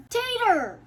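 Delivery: shouting a name across the room; loud high call to the cat. Real, not acted. Calling the cat sharply.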